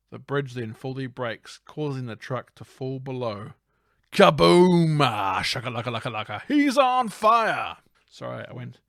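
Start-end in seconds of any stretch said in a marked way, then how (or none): 4.1-7.8 loud, explosive game commentator; NBA Jam announcer. Pretend. Host narrating the truck crash, game announcer bellow. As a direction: boom it out, stretching the final call. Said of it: "some N B A Jam stuff".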